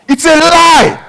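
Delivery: loud, high preaching, driving every phrase; preaching at full force. Acted. Pastor preaching to the church, loud and driving. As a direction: preach it out over the congregation.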